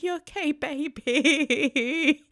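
high, loud, laughing tender question; tender to child. Retold or imitated. Mum reaching her sick daughter by phone, high tender laugh. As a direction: coo it up high through tearful laughter. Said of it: "I'm like".

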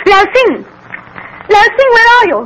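wife calling for the missing servant. Acted. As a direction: call desperately through the house.

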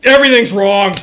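loud flat "Everything's wrong" to the waitress; blunt loud declaration. Retold or imitated. Dad telling the waitress everything is wrong, blunt and loud.

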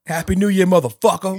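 high, loud cocky quip; action hero quip. Pretend. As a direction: toss it off like a movie hero. Joking action hero one-liner.

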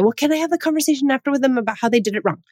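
mock eager parent. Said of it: "then people will say".

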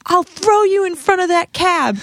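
high-pitched retold threat; reenacted outraged yelling; losing it. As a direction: act out an unhinged threat. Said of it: "just all of a sudden lost it on her".

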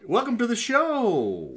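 high, loud, slow cheesy host welcome; booming hammy host greeting; game show host. Acted. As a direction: stretch it out like a TV host.

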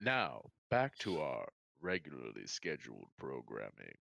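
silky late-night radio announcer; smooth radio voice. Pretend. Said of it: "This is my smooth radio voice".

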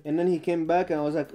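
ordinary talk

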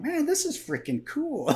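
high, slow, dazed voice; slow, high-pitched, discombobulated drawl; dazed wounded buddy. Retold or imitated. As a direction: play the wounded buddy, out of it and oddly delighted. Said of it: "being so discombobulated".

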